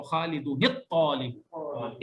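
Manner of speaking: खालिदुनित तालिबु slow, nun joined to ta; slow joined reading. Shown as a demonstration. Teacher models for students: slow खालिदुनित तालिबु. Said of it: "इस तरीके से करके पढ़ेंगे".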